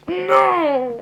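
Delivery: high, loud, drawn-out cry; anguished scream. Acted. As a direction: scream out in acted grief. Father cries out as son dies.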